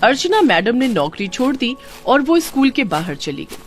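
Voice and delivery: ordinary delivery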